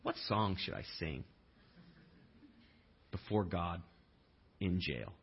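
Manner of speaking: low, soft musing; a wry low aside to himself; hushed mock question; mock thought. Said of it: "the last thing I'm thinking is".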